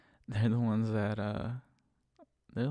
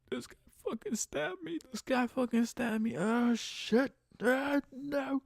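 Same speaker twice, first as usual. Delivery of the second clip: high, hammed-up panicked cry; mock high-pitched agonized whine; panicked stab victim; 13 semitones higher, slower than usual. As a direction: wail it like you're bleeding out on the phone. Imagines panicked call while bleeding out.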